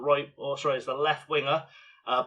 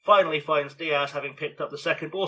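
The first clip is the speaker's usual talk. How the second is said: ordinary delivery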